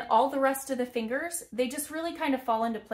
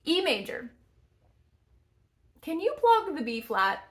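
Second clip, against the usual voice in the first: ordinary delivery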